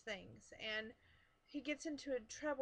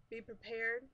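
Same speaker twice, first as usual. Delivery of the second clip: ordinary talk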